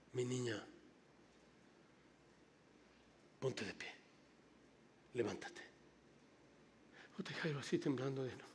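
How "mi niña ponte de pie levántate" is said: put-on softer, gentle call to a sleeping child; tender coaxing. Jesus gently waking the little girl, soft and tender. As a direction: coax her up softly like a father.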